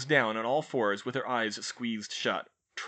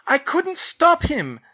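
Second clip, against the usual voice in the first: much higher, loud, slow cry; 11 dB louder, slower than usual. A narrator's reading. Hermione crying out in defense to George. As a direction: cry it out in protest. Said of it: "she cried".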